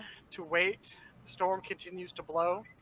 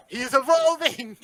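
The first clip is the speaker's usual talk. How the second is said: laughing; 8 semitones higher, 6 dB louder, slower than usual. Real, not acted. Teasing laugh at the group.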